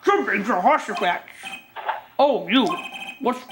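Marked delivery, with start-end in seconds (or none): 0.0-1.3 lightly voiced elderly gentleman; a hint of a kindly old man. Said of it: "what's my voice that I did for him".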